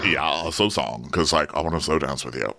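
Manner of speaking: a hint of a dopey boyfriend; put-on boy voice. Voices the boy wanting slow dance.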